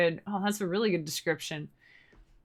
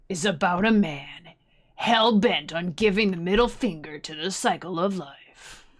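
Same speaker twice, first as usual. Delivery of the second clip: trailer guy voice. Pretend. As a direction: announce it like an epic film trailer. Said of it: "You like my trailer guy voice?".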